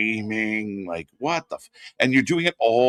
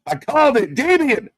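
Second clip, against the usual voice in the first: much higher, louder, slow shouted wrestling commentary; hyped play-by-play; 9 semitones higher, 5 dB louder, slower than usual. Acted. Calling Zilla Fatu's debut for viewers, loud hyped commentary. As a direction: call the debut like an arena announcer going wild.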